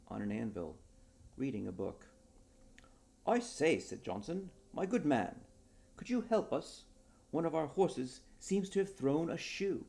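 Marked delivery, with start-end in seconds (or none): 3.2-9.8 higher, louder, genteel English gentleman; an eager gentleman asking a favour, higher and louder; polite Johnson. Acted. Johnson asking the smith for help, higher and louder. Johnson figure voiced in story.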